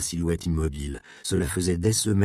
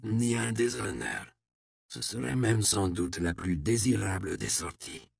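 put-on frail old man; 2 semitones higher, 5 dB quieter than usual. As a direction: speak softly like a frail old sage. Old man voiced low and soft.

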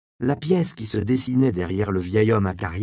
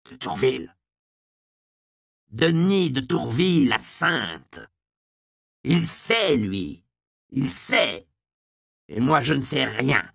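dans un souffle; 5 semitones higher, slower than usual. Acted. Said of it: "dans un souffle".

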